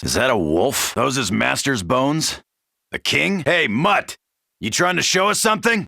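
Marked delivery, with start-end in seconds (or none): none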